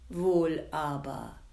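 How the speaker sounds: ordinary delivery, nothing marked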